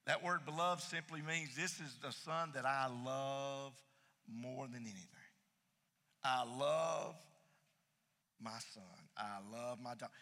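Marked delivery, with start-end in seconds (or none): 1.5-10.1 tender father. Acted. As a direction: say it gently like a loving father. Tender father's words voiced.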